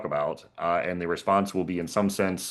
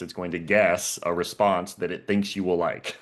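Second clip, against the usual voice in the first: slow, guess stands out above the rest; stress on guess. Shown as a demonstration. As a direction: keep it slow and weight the word guess. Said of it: "I'm emphasizing that word guess".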